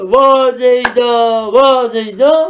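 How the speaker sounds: long, stretched mourning wail, play-acted; keen slowly, holding each vowel long; mock lament. Teacher playing the mourner who looks at the corpse, mock lament. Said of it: "ona ağlıyor".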